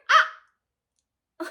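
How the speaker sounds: loud high-pitched "Ah!"; short, startled-sounding yelp. Retold or imitated. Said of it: "I was like".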